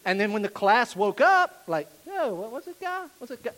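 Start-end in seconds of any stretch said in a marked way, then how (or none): 2.1-3.5 high, teasing classmate voice; a snickering kid jeering at the quiet one; mocking classmates. Waking classmates jeer at the quiet student, teasing.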